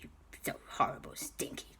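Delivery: low, soft, rough muttering; defeated villain grumble. Acted. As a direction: growl insults under your breath. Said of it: "And he went".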